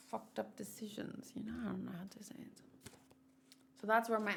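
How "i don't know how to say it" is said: quick breathy whisper under the breath; whispered aside. Real, not acted. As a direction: drop to a fast whisper. Muttered aside to self.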